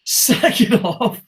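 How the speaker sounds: breaking up at being mimicked; laughing. Real, not acted. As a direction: laugh through the words.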